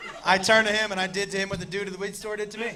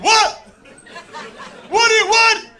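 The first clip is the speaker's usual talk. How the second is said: high, loud incredulous "what?" with a laugh; mock-outraged brother, high and loud; brother's baffled outburst; 13 semitones higher, 8 dB louder than usual. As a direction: squeal the disbelief up high. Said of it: "He was like".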